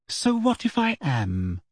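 slow, cool and offhand. A narrator's reading. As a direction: shrug it off coolly. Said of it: "said Hermione coolly".

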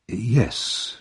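soft, careful yes; cautious. A narrator's reading. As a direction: answer warily and quietly. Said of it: "replied Mister Bumble cautiously".

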